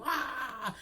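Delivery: high-pitched fake bawl; mock crying. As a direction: let out an imitated "ah" wail. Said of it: "And then she started crying again".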